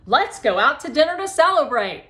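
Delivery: loud, high, cheerful invitation; cheerful announcement. Acted. Mister Deming inviting the kids to dinner, cheerful announcement. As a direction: announce the treat proudly.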